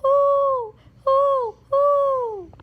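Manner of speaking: high rising owl hoots. Pretend. Child playing an owl, high playful hoots. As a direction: hoot like an owl.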